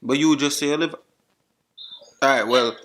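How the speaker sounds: loud Jamaican patois; Jamaican accent. Acted. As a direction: insist in heavy Jamaican patois. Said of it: "used my Jamaican accent".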